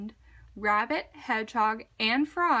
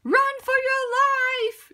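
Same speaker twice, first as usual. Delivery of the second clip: much higher, louder screaming yell; terrified yell; 13 semitones higher, 7 dB louder than usual. A narrator's reading. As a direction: scream at them to run. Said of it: "yelled Rabbit".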